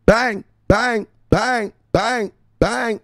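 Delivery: high, loud bang bang bang; bang shouts. Real, not acted. Streamer hyping his crypto brothers, shouting bang. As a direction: fire off each bang like a gunshot.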